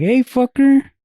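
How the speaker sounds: very high, loud friendly "Hey, fucker"; mock greeting. Imitating a casual Mexican greeting, very high and loud. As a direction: fling the greeting up high and bright. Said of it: "how the Mexicans are like".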